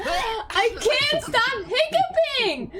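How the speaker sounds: high, slow, drawn-out whine; exasperated wail. Real, not acted. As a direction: wail it helplessly, stretching every word. Repeated complaint bursts into a wail.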